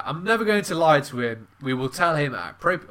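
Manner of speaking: ordinary talk, nothing marked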